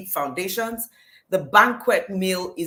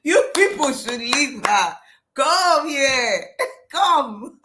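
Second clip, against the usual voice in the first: high, loud, laughing through the words; laughing taunt; 7 semitones higher, 5 dB louder, slower than usual. Retold or imitated. To the angry lady outside the restaurant, a mocking laughing call. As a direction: laugh at her while calling her over. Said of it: "I was laughing like".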